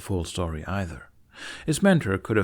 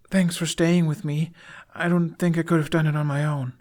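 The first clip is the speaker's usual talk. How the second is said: put-on higher, shy boyish voice; young boy's voice; 8 semitones higher, 3 dB louder than usual. A narrator's reading. Boy thanking his friend for staying, shy and higher.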